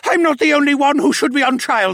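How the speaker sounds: high, loud shouting; yelled protest. Acted. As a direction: shout an accusation back at the court. Accused mage yelling at the court.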